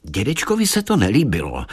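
usual speaking voice

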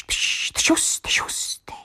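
soft unvoiced fizzing pops; hissing bubble noises; bubbling dough noises. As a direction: whisper the bubbling sounds. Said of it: "dělaly pš".

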